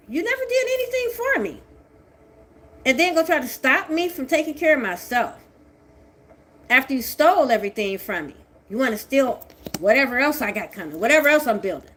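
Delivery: loud, high, raging accusation; furious yelling. Real, not acted. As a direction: hurl the blame at them. Furious rant continues.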